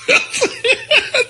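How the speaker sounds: squealing laugh of disbelief; high shocked laugh. Retold or imitated.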